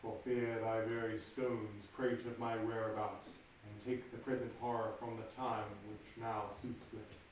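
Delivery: low, soft brooding murmur to himself; hushed soliloquy. Acted. Macbeth alone before the murder, low and hushed.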